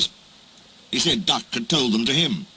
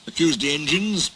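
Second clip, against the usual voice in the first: usual speaking voice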